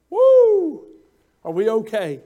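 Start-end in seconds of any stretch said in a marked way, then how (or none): 0.1-0.9 joyful whoop. Real, not acted. Exclamation after point.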